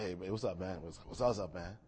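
lightly voiced street kid; low, laid-back mock slang; fake tough gangster. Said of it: "He's like".

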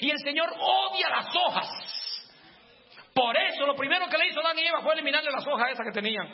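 fiery pastor crying out for repentance; full-force preaching. Acted. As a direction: preach it hard to the congregation, building to a shout.

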